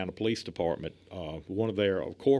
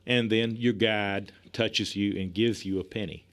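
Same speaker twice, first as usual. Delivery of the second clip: ordinary talk, nothing marked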